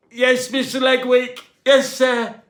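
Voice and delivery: high, loud startled answer; startled yelp. A narrator's reading. Dazed cleaner blurting to the captain, high and loud. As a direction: blurt it after the whack.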